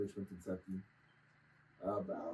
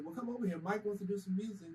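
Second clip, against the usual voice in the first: quoting cousin; 9 semitones higher than usual. Retold or imitated. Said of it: "She said".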